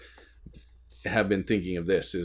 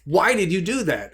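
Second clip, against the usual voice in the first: put-on higher, a frustrated plea to the baby; mock exasperated question; 8 semitones higher, 4 dB louder than usual. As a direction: ask it like a fed-up new dad. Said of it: "there's that impulse sometimes of like".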